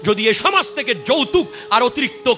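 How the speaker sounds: high-pitched, urgent warning; fiery preaching. Real, not acted. Preacher warning against dowry and a return of ignorance, urgent preaching. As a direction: press the warning on the crowd with force.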